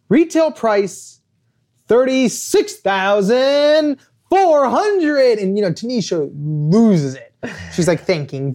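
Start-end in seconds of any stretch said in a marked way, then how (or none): 0.1-5.4 game show host. Retold or imitated. Host reveal of Tanisha's price.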